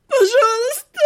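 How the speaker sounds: high, wailing sob; bawling. A narrator's reading. Captive heroine breaking down before her killer, bawling. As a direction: burst into tears on the plea. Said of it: "разревелась я".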